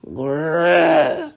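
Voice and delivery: low bear growl. Acted. Narrator to children, growling as the polar bear.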